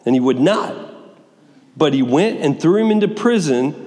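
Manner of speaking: ordinary talk, nothing marked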